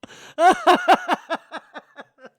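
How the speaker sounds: big, high cackling laugh; loud burst of laughter. Real, not acted. Host reading an absurd viewer comment, loud laughter. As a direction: explode into real laughter.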